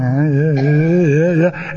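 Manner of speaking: repeated tense little bleats, imitated; inner struggle noise. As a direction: grunt through a mock inner fight. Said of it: "I'm having this fight inside".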